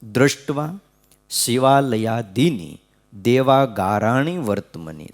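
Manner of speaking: spoken, not sung, slowly word by word: દૃષ્ટ્વા, શિવાલયાદિની, દેવાગારાણી વર્તમની; plain spoken recital of દૃષ્ટ્વા શિવાલયાદિની, unhurried and separated; slow recitation. Shown as a demonstration. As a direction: read the verse out slowly with pauses between words for the listeners. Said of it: "જો કેવું લખે".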